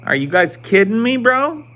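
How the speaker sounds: high, loud disbelief; can't believe what they just said; incredulous. Real, not acted. Streamer reacting to chat, loudly incredulous.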